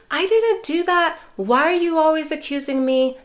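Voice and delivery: mildly indignant self-defense; mock defensive protest. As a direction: act out the hurt daughter pushing back. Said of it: "She didn't go into".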